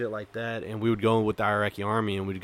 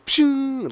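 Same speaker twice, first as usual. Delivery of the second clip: high whizzing bullet noise; imitated gunfire; 5 dB louder than usual. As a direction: mimic a round zinging past. Imitating incoming rounds flying past.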